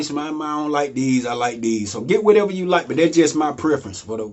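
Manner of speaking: usual speaking voice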